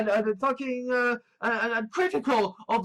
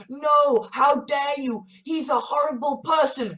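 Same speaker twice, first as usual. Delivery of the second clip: raised, high-pitched indignant protest; outrage; 4 semitones higher, 3 dB louder than usual. Retold or imitated. Objectors react to praise of a horrible person, outrage. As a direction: imitate an outraged objector, loud at first. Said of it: "genuine outrage of".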